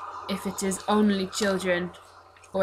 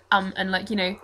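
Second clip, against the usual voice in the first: ordinary talk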